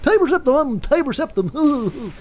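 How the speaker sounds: high, slow, grandly declaiming the name Tiber Septim; pompous emperor voice. Retold or imitated. Mocking how Tiber Septim would announce himself, high and slow. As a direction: proclaim your own name like a self-important emperor. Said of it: "that's how he would talk".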